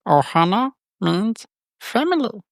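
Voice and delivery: high, slow alien creature rasp; squeaky little alien voice; Stitch voice. Acted. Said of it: "Elliot? Elliot?".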